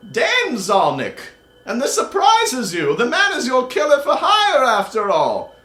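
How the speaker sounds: put-on high old wizard crying out at the lady; excited old wizard. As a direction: exclaim like an agitated old wizard. GM voicing Delden.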